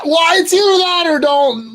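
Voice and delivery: loud and high, defending himself; defensive shout. Real, not acted. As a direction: blurt it out loudly in self-defense. Mock defensive outburst.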